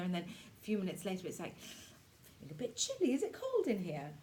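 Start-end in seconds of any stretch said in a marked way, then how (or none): 1.6-4.1 higher-pitched chilly complaint; acted chill. Teacher acting out the chill minutes later, high chilly voice. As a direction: play feeling the cold, voice lifted. Acts out feeling chilly again.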